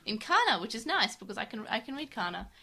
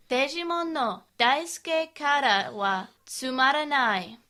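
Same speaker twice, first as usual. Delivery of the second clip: slow, louder Japanese, each word drawn out; careful Japanese reading; 5 semitones higher, 6 dB louder, slower than usual. Shown as a demonstration. Host reads comic haiku to co-host, slow Japanese recital. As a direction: recite slowly and loudly in Japanese. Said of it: "So I will read the Japanese in Japanese".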